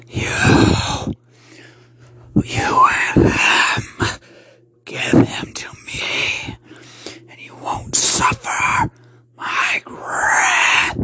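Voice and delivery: slow, whispered monster rasp; menacing voiceless rasp, words drawn out; raspy creature. Acted. Creature demanding the boy from the uncle, raspy. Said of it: "it said in a raspy tone".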